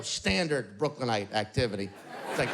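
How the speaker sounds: ordinary talk, nothing marked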